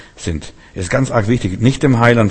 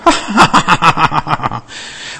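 high, hidden giggle; Sarah's laugh; 5 semitones higher than usual. Acted. Sarah behind the curtain giggling in disbelief. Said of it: "grinst und lacht".

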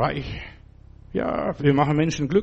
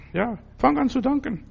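high urging; 9 semitones higher than usual. Real, not acted. Preacher urges thanks.

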